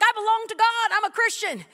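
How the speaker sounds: high, loud boastful claim; mock proud churchgoer. Mocking church members who boast of belonging, loudly. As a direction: ham up the self-assured member. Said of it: "people right now that are like".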